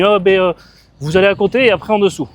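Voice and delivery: high, loud mock direction-giver; hesitate, then call out muddled directions; Sylvain Augier. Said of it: "C'était Sylvain Augier". (imitation moqueuse, voix haute et forte)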